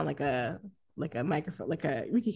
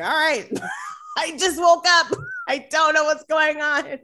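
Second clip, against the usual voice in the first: groggy Adam; 7 semitones higher than usual. Retold or imitated. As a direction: shout it like a dazed, just-woken man. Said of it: "Adam being like".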